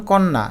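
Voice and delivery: কন্যা as "kon-na"; doubled n. Shown as a demonstration. Teacher showing doubling, কন্যা as "kon-na". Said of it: "ন দুইবার হয়ে গেল".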